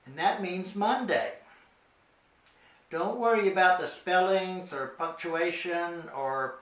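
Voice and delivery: ordinary talk, nothing marked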